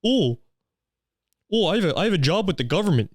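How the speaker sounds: high-pitched, preening mockery; mock self-important intellectual. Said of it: "think they're fucking smart and political".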